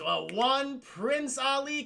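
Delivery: high, slow, drawn-out proclamation; mock grand announcement. Genie begins granting the wrong wish, mock grand announcement. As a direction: stretch each word like a showman.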